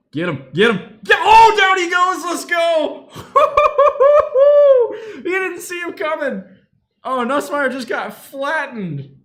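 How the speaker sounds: laughing screams of joy at the big hit; excited shouting. Real, not acted. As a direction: roar as the quarterback gets flattened. Big sack during live game.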